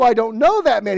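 high, loud, fast denial. Acted. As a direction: shout the denial. Peter doubles down.

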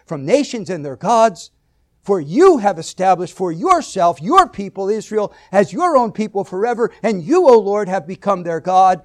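"you", "your" and "yourself" punched high and loud; stress on YOU and YOUR. Shown as a demonstration. Preacher reading David's response to the covenant, "you" and "your" stressed. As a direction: hit each "you" and "your" hard. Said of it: "put emphasis on certain words".

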